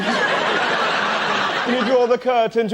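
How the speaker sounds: slow strained plea. Acted. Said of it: "dialogue with doctor".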